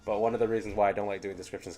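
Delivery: ordinary talk, nothing marked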